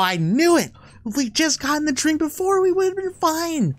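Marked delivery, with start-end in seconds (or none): none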